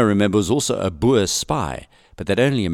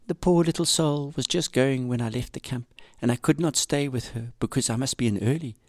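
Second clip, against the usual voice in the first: softer, higher diary voice; reading a diary; 6 semitones higher, 5 dB quieter than usual. Acted. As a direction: read it gently as her diary entry. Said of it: "she writes in her diary".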